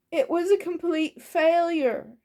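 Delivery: high, loud lament; despairing goblin cook. A narrator's reading. Goblin cook tells master of her failure, despairing. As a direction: wail it in defeat.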